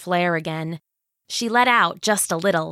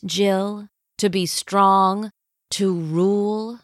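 scheming villain queen. Acted. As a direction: savor every word like a tyrant. Villain tempts Jill.

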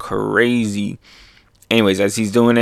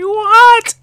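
very high, loud squawk of disbelief; hammed-up astonishment; shocked crowd; 6 dB louder than usual. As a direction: shriek it in cartoon shock. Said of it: "They're like".

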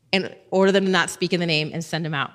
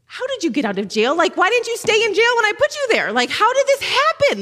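much higher and louder, incredulous squeal; high baffled council voice; 14 semitones higher, 4 dB louder than usual. Retold or imitated. Pastor voicing the stunned council, high and squeaky. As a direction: play the flustered council, voice shooting up.

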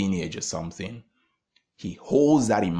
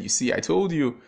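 excited; 8 semitones higher, 4 dB louder than usual. Retold or imitated. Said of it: "he was so excited".

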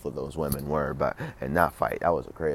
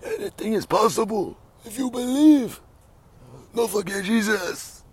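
much higher Cuban-accented Yoel Romero impression; 4 dB louder than usual. Podcaster mimics fighter Yoel Romero on mic, in a high, exaggerated Cuban accent. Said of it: "Michael Bisping's Yoel Romero accent".

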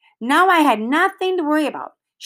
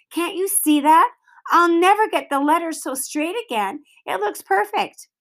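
Sheila whining; 6 semitones higher than usual. A narrator's reading. Sheila defends her cover.

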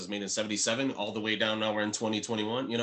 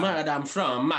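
put-on higher, louder Jamaican accent echo; fake Jamaican accent; 4 semitones higher, 5 dB louder, much slower than usual. Co-host echoing the disguised man, fake Jamaican accent. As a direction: chime in with a mock Jamaican accent. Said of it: "Jamaican accent".

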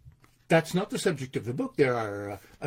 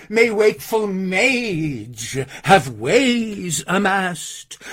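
louder, declamatory verse with drawn-out words; poem recitation; 3 semitones higher, 6 dB louder, slower than usual. Acted. Violinist psalming like King David to audience, slow declaimed recitation. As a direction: proclaim the psalm, stretching each key word.

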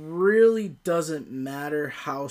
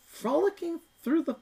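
very high, sing-song falsetto; mock-girlish high-pitched lilt; frolicking princess; 3 dB louder than usual. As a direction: put on a squeaky, dainty princess voice.

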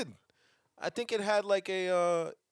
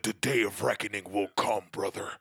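lower, put-on menacing villain voice; ominous villain voice; 7 semitones lower than usual. Quoting the pizza villain's threat to friend, mock menace. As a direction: intone it like a cheesy TV villain. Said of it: "He said".

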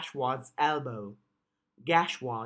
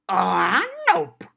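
bright chirpy nope, high and loud; 9 semitones higher, 7 dB louder than usual. Acted. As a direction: chirp it out cheerfully. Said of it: "Cherry said brightly".

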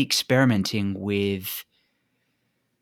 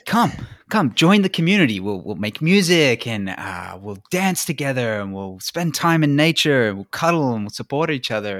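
high, lilting, coaxing call to join; sweet enticing invitation; 3 semitones higher, 5 dB louder than usual. Retold or imitated. Host luring people into the community, sweetly coaxing. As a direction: lure them in with sugar in the voice. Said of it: "I, I'm like".

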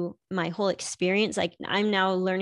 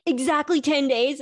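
higher, louder eager question; 7 semitones higher, 5 dB louder than usual. Retold or imitated. Husband presses for the exact count, higher and louder eagerly. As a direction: jump on the number. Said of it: "And he's like".

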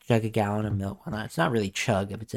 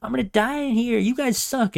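fast, much higher whiny rant; Muscle Man's voice; 10 semitones higher, 4 dB louder, faster than usual. Retold or imitated. Muscle Man scolding the guys from the necklace, fast and high. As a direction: rattle it off high like an indignant little guy. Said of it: "He's like".

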